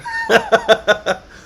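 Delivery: high, loud giggle; real high-pitched cackling laugh; burst of laughter. Real, not acted. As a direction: laugh at your own failure. Laughs at bad verdict on accent.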